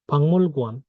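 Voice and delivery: "পাংমুল গুয়ান" with ng before m; nasalised pronunciation. Shown as a demonstration. Teacher answering with the changed sound, nasalised pronunciation. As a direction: join "পাংমুল" with a held ng. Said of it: "না হয়ে কি হবে".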